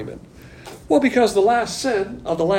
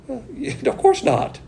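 higher, laughing it off; amused laugh at the objection; 9 semitones higher than usual. Real, not acted. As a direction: chuckle through the words.

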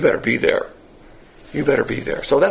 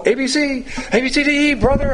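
high mock sing-song chant; mock entertainer; 10 semitones higher than usual. Pastor showing the class what he won't do, mock entertainer. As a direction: imitate a showy entertainer, pitched way up. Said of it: "march up and down and sing songs".

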